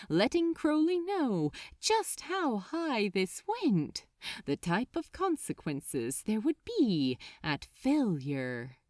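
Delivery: ordinary delivery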